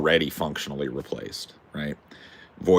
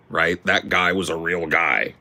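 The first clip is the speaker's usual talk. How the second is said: normal talking voice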